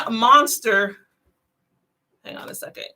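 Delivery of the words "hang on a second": whispered aside. Real, not acted. Whispering to viewers while reading the private message. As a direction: drop to a whisper so nobody hears.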